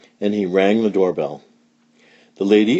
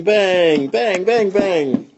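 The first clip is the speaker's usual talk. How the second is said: ordinary delivery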